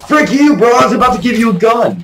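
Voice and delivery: very high, loud, rapid shouting; high-pitched burst of indignant shouting; outraged yell. Real, not acted. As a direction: yell at the player who betrayed you. Yelling at player who attacked.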